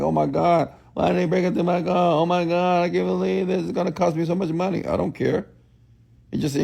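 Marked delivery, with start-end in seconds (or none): 0.0-4.8 higher, whiny mock panic over a car; mock whining. Coach mocking a complainer, higher whiny mock panic. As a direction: poke fun at a complainer's fretting. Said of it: "I'm like".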